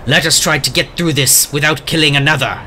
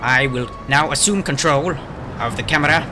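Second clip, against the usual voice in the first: nature documentary; 5 dB quieter than usual. Acted. Reporter intro with documentary narration.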